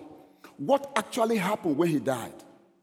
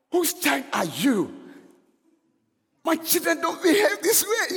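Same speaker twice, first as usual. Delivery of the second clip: mom scolding; 11 semitones higher than usual. Retold or imitated. Mom scolding her misbehaving son, high mock scold. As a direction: play the strict mom catching her boy. Strict mom voiced in story.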